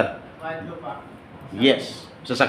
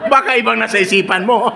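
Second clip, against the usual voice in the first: higher, louder laughing tease; louder teasing laugh; 8 semitones higher, 4 dB louder than usual. Real, not acted. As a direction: repeat the tease, laughing harder. Repeated tease. (mas malakas na panunukso habang tumatawa)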